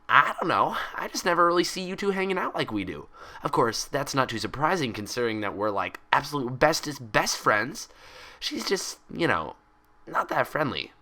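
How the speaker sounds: put-on high, bouncy cheerful girl; bubbly Pinkie Pie voice. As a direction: chatter it out like an excitable pony.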